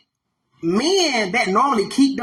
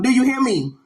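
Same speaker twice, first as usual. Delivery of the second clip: normal talking voice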